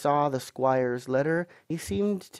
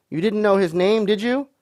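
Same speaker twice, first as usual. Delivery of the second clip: high, louder leading question; stern questioning; 7 semitones higher, 6 dB louder than usual. A narrator's reading. Landlord questions Morgan about the name, stern. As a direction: press him for the answer.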